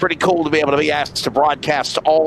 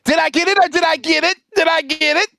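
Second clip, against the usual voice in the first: excited; 10 semitones higher than usual. Real, not acted. Gloating after a correct call.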